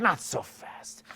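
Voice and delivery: slow, breath-filled tease; halting someone leaving. Shown as a demonstration. Coach stopping her heading to the bathroom, drawn-out and whispery. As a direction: stretch it out, mostly on breath. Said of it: "she wants to go to the bathroom".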